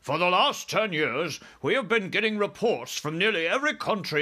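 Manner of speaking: army chief, urgent. Acted. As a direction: report alarming news as a military man.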